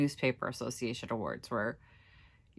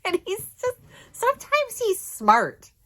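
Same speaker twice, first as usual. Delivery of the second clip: tearful laughing; 5 dB louder than usual. Real, not acted. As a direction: talk through a real laugh. Laughing through tears about husband.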